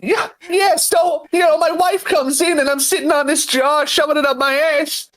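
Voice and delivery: high-pitched stand-up storytelling impression; Bill Burr. Comedian telling about his wife walking in, high ranting impression. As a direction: tell it as a high-voiced, ranting comic. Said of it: "progressively starting to sound like Bill Burr".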